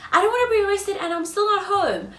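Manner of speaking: mock-sweet reply to a polite ask; mock eager agreement. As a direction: play it over-accommodating. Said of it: "I would have been like".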